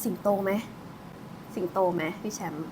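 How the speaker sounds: hushed aside; hushed question. Real, not acted. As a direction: ask under the breath.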